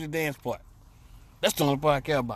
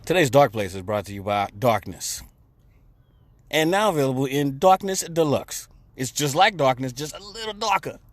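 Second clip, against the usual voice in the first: ad read; 4 dB louder than usual. Acted. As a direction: sell it like a cheesy commercial. Mock sponsor ad read.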